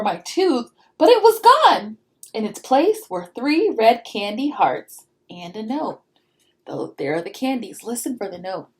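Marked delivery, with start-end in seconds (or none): none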